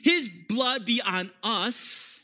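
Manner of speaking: high, loud, slow shout; crowd shouting. Acted. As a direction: shout it as the mob before Pilate. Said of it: "they shouted".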